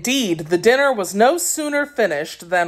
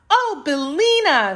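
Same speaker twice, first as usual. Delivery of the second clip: put-on high, delighted girl's greeting; glad cry; 11 semitones higher than usual. A narrator's reading. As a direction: cry out gladly, pitched way up. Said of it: "uttering at the same time a glad cry".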